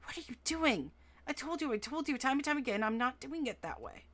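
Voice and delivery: hushed, sharp and angry; angry whisper. A narrator's reading. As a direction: scold under your breath. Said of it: "whispering angrily".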